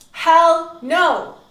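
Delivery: high, loud outburst; emphatic refusal. Real, not acted. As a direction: refuse it outright, loudly. Emphatic rejection of faking.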